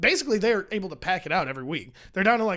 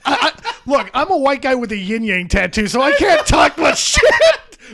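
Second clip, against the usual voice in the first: laughing; 5 semitones higher, 10 dB louder than usual. Real, not acted. Laughing about own tattoo.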